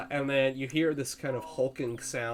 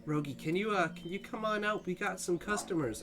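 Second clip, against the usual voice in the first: hesitant, slightly higher sylph voice; sylph calling politely; 5 semitones higher, 4 dB quieter than usual. Pretend. Sylph calls Rogi out for customers, hesitant coaxing. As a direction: coax tentatively through a closed door. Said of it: "and he says".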